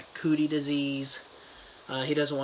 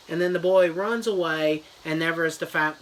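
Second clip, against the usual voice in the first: ordinary talk, nothing marked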